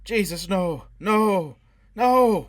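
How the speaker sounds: hysterical screaming. A narrator's reading. Interrogated man screaming hysterically at Foyle in terror. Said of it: "Forrest began to scream hysterically".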